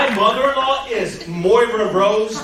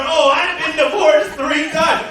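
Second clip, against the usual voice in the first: higher, laughing mock-brag shout; mocking her boast; 7 semitones higher than usual. Comedian mimicking the divorced heckler, laughing shout.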